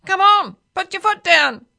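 high, loud parrot shout; impatient parrot. A narrator's reading. Parrot pushes the driver to speed up, shouting. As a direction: urge the driver on at full volume. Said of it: "said the parrot".

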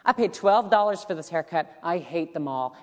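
usual speaking voice

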